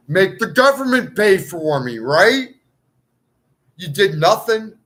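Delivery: indignant rant. Real, not acted. Ranting at the government, loud and indignant. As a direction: jab each phrase at them.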